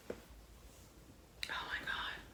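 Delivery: whispered, slow and breathless; awed whisper. Real, not acted. Vlogger whispering over a beautiful shirt, awed. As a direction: whisper it in awe.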